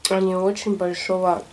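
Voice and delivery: words muffled by chewing; talking while chewing. Real, not acted. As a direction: mouth full of food. Seen 1: Cheeks bulge and jaw works through the words; food in mouth.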